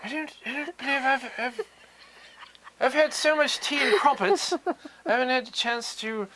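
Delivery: high, prim mock British gent; mock English accent. Streamer answering a viewer, in a mock posh English accent. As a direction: play a dainty English gentleman. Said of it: "Hello, I'm [name]".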